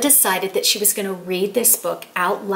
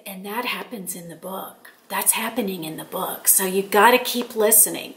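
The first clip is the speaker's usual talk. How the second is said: hushed confiding; 2 semitones lower, 7 dB quieter than usual. Retold or imitated. Grandmother teasing grandson about the book, hushed. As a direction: drop right down as if confiding in a child.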